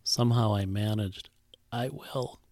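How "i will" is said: barely voiced, throat tight; choked up. Mother answering the old man, choked and very soft. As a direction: force it out past a lump in the throat. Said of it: "from a throat that contained a stone".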